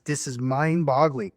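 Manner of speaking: normal talking voice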